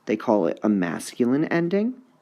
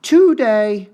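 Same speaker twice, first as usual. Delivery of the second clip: Today, DAY sung much higher and louder; stress test on Today; 11 semitones higher, 10 dB louder than usual. Shown as a demonstration. Teacher exaggerating stress on Today. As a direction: stretch Today up high on the end. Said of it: "one you really have to hear".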